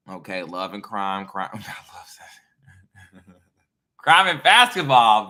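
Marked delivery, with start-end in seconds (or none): 1.5-4.0 breathy whispered aside. Real, not acted. Quietly delighted at the crime pun, whispered. 4.0-5.3 loud, slow, laughing. Real, not acted. Cracking up at her own joke, laughing loud.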